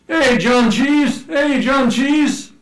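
high, loud, drawn-out taunting call; mock calling out. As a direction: holler a nickname across the street. Acting out nickname being called out.

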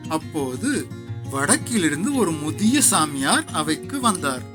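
ordinary delivery, nothing marked

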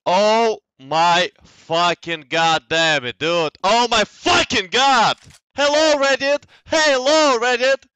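high, loud yelling in disbelief; excited shouting. Real, not acted. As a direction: scream it out in triumph. Clutch moment, streamer screaming.

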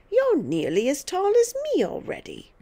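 high-pitched, exasperated grandmother; outraged old woman's complaint; indignant scolding. A narrator's reading. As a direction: accuse the child with swooping pitch.

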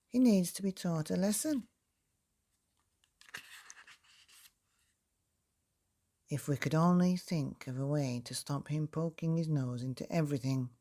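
lightly voiced painter, keen; a hint of an eager tradesman; Mister Bush. A narrator's reading. Painter Mister Bush to the townsfolk, lightly voiced. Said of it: "said Mister Bush, the painter".

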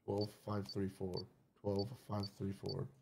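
drawn-out murmured numbers; slow quiet repeat. Real, not acted. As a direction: repeat it slowly under your breath. Memorizing code, slow quiet.

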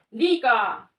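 loud, drawn-out model reading; loud demo. Shown as a demonstration. Teacher showing the child how to read loudly, loud and clear. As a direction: say it out clearly for a pupil. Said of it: "Дивись, у мене громко".

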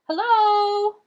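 high, loud, drawn-out hail; calling out. A narrator's reading. Girl calling into the shadows of a shed. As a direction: call into a dark room to find someone. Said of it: "she called into the shadows".

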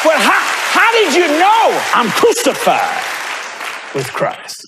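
high, stretched-out preacher's wail; slow, soaring pulpit cry; fervent preaching cry. Real, not acted. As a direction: draw it out slowly, pitched high. Preacher cries out at full pitch.

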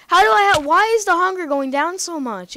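high, loud panicked shouts; panicked yelling. Real, not acted. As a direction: yell in alarm as the hunger drops. Hunger dropping, sudden panic.

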